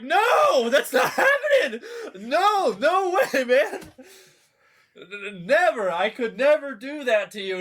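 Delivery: loud, very high, laughing protest; frantic shouted no to the friend offering her; panicked denial. Retold or imitated. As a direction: deny it too hard, laughing.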